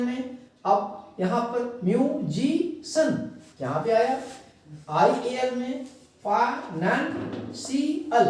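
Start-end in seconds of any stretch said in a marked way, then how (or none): 1.8-3.3 म्यूजिशियन split into myu, ji, shan; slow, spaced-out syllables in म्यूजिशियन. Shown as a demonstration. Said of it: "अलग-अलग syllable को मैंने line खींच दिया". 6.2-8.2 फाइनेंशियल slowly split into fai, nan, si, al; slow, spaced-out syllables in फाइनेंशियल. Shown as a demonstration. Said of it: "अलग-अलग syllable को मैंने line खींच दिया".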